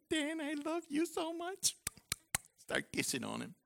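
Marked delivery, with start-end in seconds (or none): 0.1-1.8 mock tender. Preacher acts out hugging a man.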